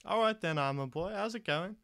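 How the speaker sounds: put-on bright hearty hello to Armor Boy; Asta greeting. GM voices Asta greeting Croak.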